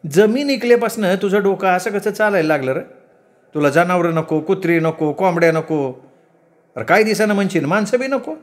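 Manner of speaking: high-pitched, exasperated mother; scolding village mother. Acted. Mother scolding her son since the land sale, exasperated.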